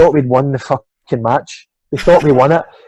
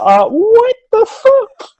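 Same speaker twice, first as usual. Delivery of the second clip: stretched, much higher slow cry of disbelief; exasperated disbelief; much slower than usual. Retold or imitated. Team told there's no hotel reservation, drawn-out disbelief. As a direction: let it drag out in retold outrage. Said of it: "And you're like".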